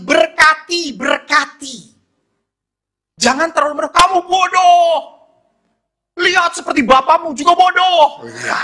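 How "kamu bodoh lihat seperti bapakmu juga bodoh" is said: high, forceful parental telling-off; mock scolding. Preacher voicing a parent cursing a child, mock scolding. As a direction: scold in a raised, high-pitched voice.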